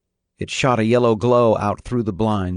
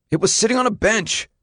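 high-pitched protesting son; defensive teenage son; 12 semitones higher than usual. A narrator's reading. Son explaining where he found it, defensive and high. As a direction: insist on it, voice pitched well up.

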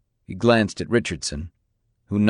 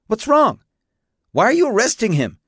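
very high, louder, urgent voice; alarmed woman; 13 semitones higher, 4 dB louder than usual. A narrator's reading. Mona rushing down the road to the arrest, high alarm. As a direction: cry out in high-pitched alarm.

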